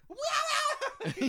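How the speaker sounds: very high, loud imitated animal yowl; squeal. Host imitating the bitten wolf for a co-host, high squeal.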